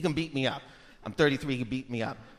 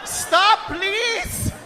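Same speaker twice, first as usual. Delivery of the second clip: high, loud pleading cries; hammed-up desperate dad; mock begging; 11 semitones higher, 4 dB louder, much slower than usual. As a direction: plead for the fight to end. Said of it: "He's begging".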